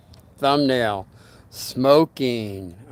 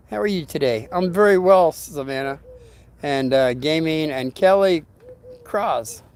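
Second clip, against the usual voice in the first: ordinary talk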